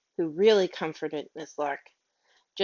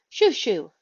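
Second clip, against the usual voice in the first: louder shooing cry; 3 semitones higher, 6 dB louder than usual. Acted. Miss Lark shooing the stray dog away, loud cry. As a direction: shoo the dog off with a raised voice. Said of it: "she cried".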